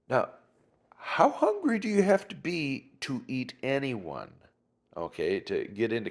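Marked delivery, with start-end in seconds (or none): none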